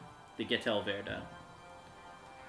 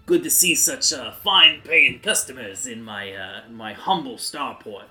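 self-important starport man; 5 dB louder than usual. Acted.

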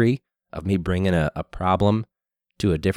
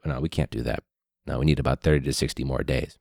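low, soft brush-off; lowered, quiet mock stalling; a hint of a bored bureaucrat; mock dismissive official; 6 semitones lower, 5 dB quieter than usual. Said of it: "And they're like".

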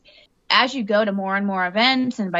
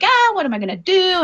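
panicky; 10 semitones higher than usual. Retold or imitated. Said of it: "I was freaking out".

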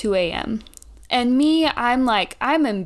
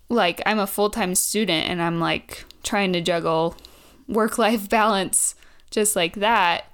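ordinary talk, nothing marked

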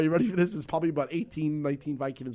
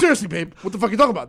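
louder, insistent fake innocence; pressing the denial; 5 semitones higher, 8 dB louder, much faster than usual. To his wife, insistent, louder denial. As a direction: push the lie hard. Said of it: "I gotta keep pressing it hard".

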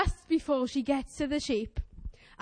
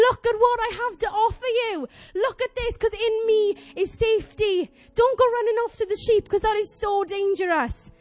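high, raised pleading voice; God pleading urgently; 7 semitones higher, 5 dB louder than usual. Retold or imitated. God urging his people back to safety, pleading. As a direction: plead them away from danger. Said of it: "that's how God is to us".

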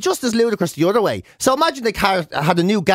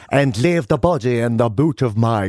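put-on low, slow gangster in a phoney Dublin accent; badly faked Dublin accent; 6 semitones lower than usual. Soap gangster ordering a killing, badly faked Dublin accent. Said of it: "What do you think of my Dublin accent".

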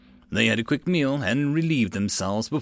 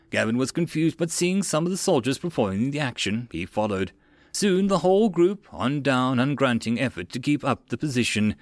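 ordinary delivery

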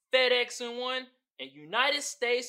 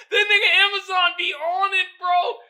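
laughing through the words, loud and high; 12 semitones higher, 7 dB louder than usual. Real, not acted. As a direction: blurt it out while cracking up. Laughing at the meme.

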